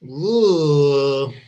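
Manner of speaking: loud drawn-out ugh of disgust; loud groan of disgust. Real, not acted. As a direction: groan at what you just saw. Disgust at clip.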